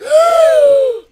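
sharp startled gasp; loud shocked gasp. Real, not acted.

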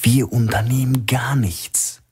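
hissed in a tense whisper. A narrator's reading. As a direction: spit the words out under your breath. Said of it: "fauchte der Filialleiter". (zischend geflüstert, gereizt)

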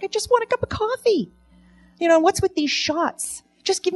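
exasperated plea. Retold or imitated. Exasperated at coffee menu.

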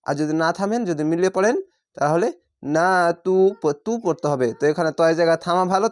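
ordinary talk

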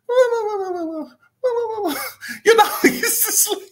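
very high, words squeezed out through laughing; helpless laughter. Real, not acted. Reviewer cracking up over bad music, helpless laughter. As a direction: try to talk while cracking up. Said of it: "I'm glad I'm laughing about it".

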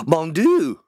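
gasp, then a loud, high French exclamation; hammed-up astonishment in French; mock French shock. Host hearing of the French translation, mock French shock.

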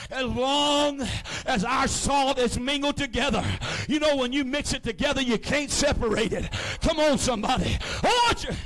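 loud, high, shouting preacher's climax; preaching at full force. Acted. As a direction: preach it out hard, lifting the whole room.

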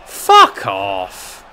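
loud, angry yell; angry outburst. Real, not acted. Gamer cursing an opponent, angry outburst. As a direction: burst out loudly at the opponent. Gamer losing, yelling at the fight.

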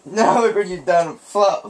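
ordinary talk, nothing marked

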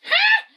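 a high, loud victory yelp; triumphant shout. Real, not acted. Won rock paper scissors.

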